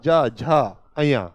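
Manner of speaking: च, छ, ज, झ drawn out slowly one by one; slow sound demo. Shown as a demonstration. Teacher to pupils, slowly sounding out palatal letters. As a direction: sound out each letter slowly so the class hears the tongue. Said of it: "देखो जीभ टकरा रही है ऊपर".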